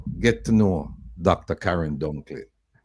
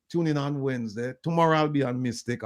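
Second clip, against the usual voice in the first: ordinary talk, nothing marked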